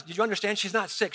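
ordinary talk, nothing marked

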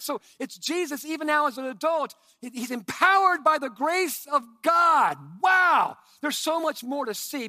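high, loud preaching shout; a preacher shouting his point home. Real, not acted. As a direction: let it ring out at full force.

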